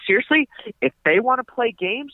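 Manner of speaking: high, hammed-up threats; mock threatening rant. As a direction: threaten like a blustering landlord. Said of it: "he's like".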